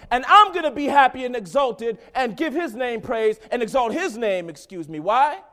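loud, high preaching build; full-force preaching. Real, not acted. Preacher rousing the congregation, loud and high. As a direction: preach it out to the church.

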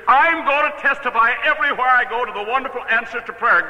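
high-pitched zealous declaration; re-enacted burst of enthusiasm; fervent vow. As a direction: proclaim it like an elated testimony. Retells his fervent vow to seller.

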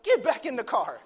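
put-on loud, high command to a child; father's order. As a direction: act out a dad calling her back. Father orders daughter back inside.

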